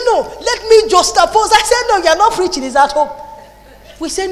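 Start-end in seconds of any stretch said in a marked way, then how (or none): 0.0-3.1 retold argument. Acted argument with husband.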